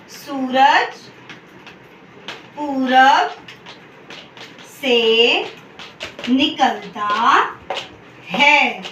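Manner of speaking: सूरज पूरब से निकलता है with long pauses between words; slow word-by-word dictation. Shown as a demonstration. Teacher dictating the corrected sentence word by word to students. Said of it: "हम क्या लिखेंगे".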